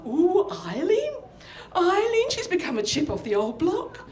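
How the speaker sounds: high, swooping gossipy cooing; aunt's admiring friends. Retold or imitated. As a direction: coo it to Eileen, impressed by her great-niece. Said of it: "said her friends who were sitting all around".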